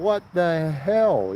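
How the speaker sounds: slow, high, puzzled "what the hell"; bewildered. Retold or imitated. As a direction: drag out the disbelief. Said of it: "the boy is thinking".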